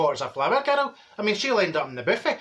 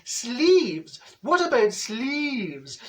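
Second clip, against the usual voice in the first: shop lady voice; 6 semitones higher, much slower than usual. Retold or imitated. Barbara voiced again.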